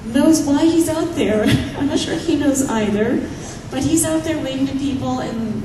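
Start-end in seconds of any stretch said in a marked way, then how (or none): none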